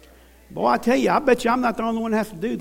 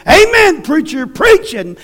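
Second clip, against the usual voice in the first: amen corner call; 6 semitones higher, 6 dB louder than usual. Retold or imitated. Old boy calling out amen.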